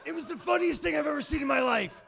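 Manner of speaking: high, loud laugh through the words; can barely get it out for laughing; shouted through real laughter; laughing hard. Real, not acted. Cracking up at kid's accident.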